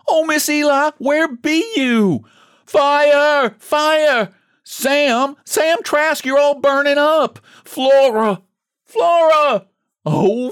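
shouting fire. Acted.

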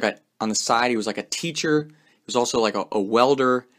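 usual speaking voice

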